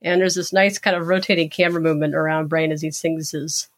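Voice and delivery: ordinary talk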